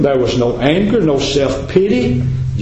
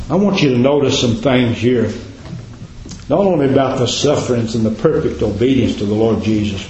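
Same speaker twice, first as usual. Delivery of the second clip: ordinary talk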